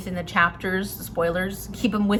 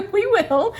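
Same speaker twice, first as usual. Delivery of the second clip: laughing; 11 semitones higher than usual. Real, not acted. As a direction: giggle through the words as you give in. Laughing while agreeing to continue.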